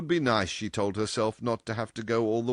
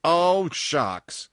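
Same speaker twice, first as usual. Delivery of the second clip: loud, higher burst of impatience; exclaiming cry; 9 semitones higher, 7 dB louder than usual. A narrator's reading. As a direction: cry out "Oh, shucks!" as the brusque boss. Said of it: "he cried".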